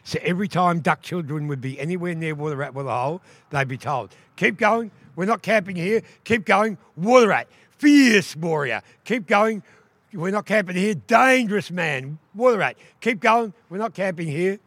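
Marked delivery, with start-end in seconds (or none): 4.3-14.6 high, loud, sing-song warning call. Acted. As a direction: call the children on, chanting the warning. Elders warn children away from waterhole.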